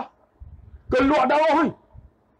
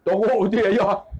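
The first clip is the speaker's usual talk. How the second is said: ordinary delivery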